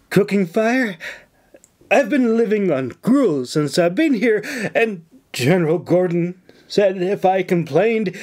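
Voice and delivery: blubbering. A narrator's reading. Nora telling the governor of her hardship, blubbering. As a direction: blubber it with put-on misery. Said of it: "she blubbered".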